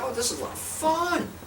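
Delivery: high, sing-song imitation of delighted officers; lightly mocking the lawmen's glee; mock cheery marshals. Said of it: "they're like".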